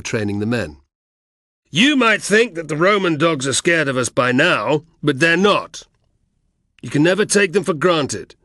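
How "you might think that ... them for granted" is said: loud and high, bellowing at the men; roaring drill speech. Acted. Egbeo drilling the rebel cohort, roaring. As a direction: roar it across the training ground. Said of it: "the Thracian had roared over and over".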